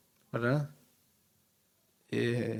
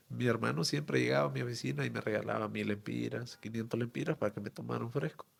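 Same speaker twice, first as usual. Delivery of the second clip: ordinary talk, nothing marked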